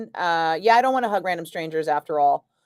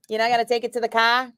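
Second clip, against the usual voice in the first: ordinary delivery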